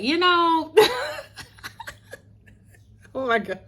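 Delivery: high, giddy laughter breaking through; giggling. Real, not acted. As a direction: laugh it out, flustered and delighted. Smitten by Rondo.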